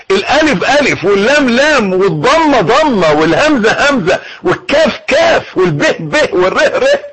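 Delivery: each letter named and articulated with care. Shown as a demonstration. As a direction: spell out each sound of the takbir. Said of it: "عايزك تقولها صح".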